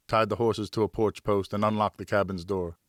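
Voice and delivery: ordinary delivery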